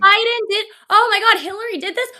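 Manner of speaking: high, loud, hammed-up gasping voice; mock panicked voter. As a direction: ham up a shocked, gullible voter. Mocks gullible voters.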